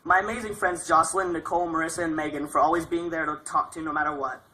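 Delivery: normal talking voice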